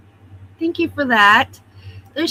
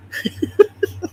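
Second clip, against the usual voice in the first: light breathy laugh; 9 semitones higher than usual. Real, not acted. Laughing at screaming phone.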